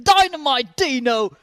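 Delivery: big drawn-out Aussie shout of the name; Aussie accent. Shown as a demonstration. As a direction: yell it like an Australian commentator. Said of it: "throw in that Aussie accent".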